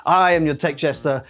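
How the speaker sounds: ordinary talk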